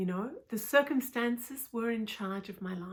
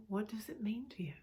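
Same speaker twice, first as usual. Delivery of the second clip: normal talking voice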